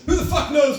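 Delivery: high, loud punchline shout; loud exclamation. Real, not acted. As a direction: throw the line out loud.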